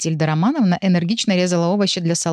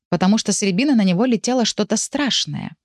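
usual speaking voice

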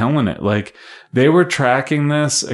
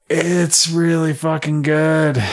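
high, slow, stretched-out praise; drawn-out rave; 7 semitones higher, slower than usual. Real, not acted. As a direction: stretch every word out high. Emphatic verdict on the film.

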